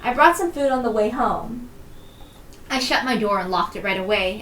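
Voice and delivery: ordinary delivery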